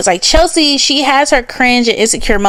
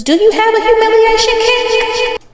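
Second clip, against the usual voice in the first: high, teasing mock question; 11 semitones higher than usual. A creator mocking a tweeter, high and teasing.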